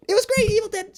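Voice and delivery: very high, loud gushing; real excitement. Real, not acted. Fan gushing about Evil Dead, very high and loud. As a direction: burst out with fan joy. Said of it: "I'm getting excited".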